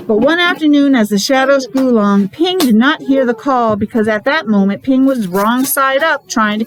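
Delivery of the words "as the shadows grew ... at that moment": ordinary delivery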